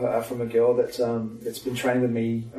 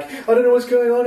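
put-on panicked girl quoted; 12 semitones higher, 7 dB louder than usual. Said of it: "and she's like".

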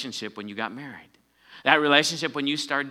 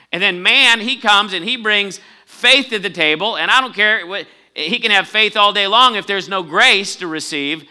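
full-force preaching; 6 semitones higher, 8 dB louder than usual. Acted. A preacher pressing the point on his congregation, full force. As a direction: drive it home from the pulpit like a revival preacher.

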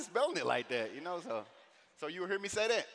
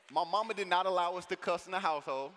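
ordinary delivery

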